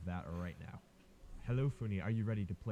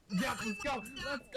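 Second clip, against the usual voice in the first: excited cheer; 14 semitones higher than usual. Real, not acted. Cheering a win in game.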